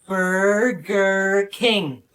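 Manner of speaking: drawn out very slowly. Acted. Waiter to customers, pronouncing very slowly. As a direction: say it at a crawl.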